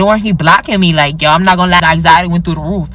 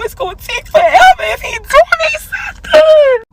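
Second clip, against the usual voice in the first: exasperated outburst. Retold or imitated. Alarmed, far from home.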